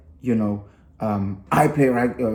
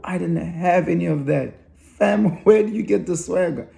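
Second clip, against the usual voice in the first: high, amazed laughing disbelief; 6 semitones higher than usual. Retold or imitated. Father to his confident son, high amazed laugh.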